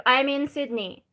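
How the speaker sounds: ordinary delivery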